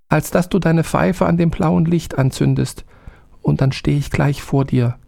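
high little servant voice; the small spirit pledging obedience. A narrator's reading. Little man tells the soldier he must obey, high. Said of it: "sprach das Männchen".

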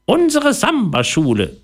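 proud, high little mouse voice. A narrator's reading. Mouse showing off her samba school, proud squeak. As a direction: present it grandly in a tiny high voice. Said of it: "sagte Theodora stolz".

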